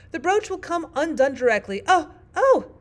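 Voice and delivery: high, loud alarmed Queen; groaning, crying out. A narrator's reading. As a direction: build to cries of pain. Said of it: "the poor Queen groaned out".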